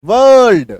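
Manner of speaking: "वर्ल्ड" loud with every letter, r and l sounded; loud "वर्ल्ड" sounding every letter; full spelling sound. Shown as a demonstration. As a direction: say all of "वर्ल्ड", r and l clearly. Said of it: "हम लोग ऐसे लिखते क्या है".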